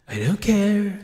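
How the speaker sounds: "I don't care" pushed out loud and high, "care" falling; loud mic-level test. Shown as a demonstration. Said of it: "testing the compressor levels".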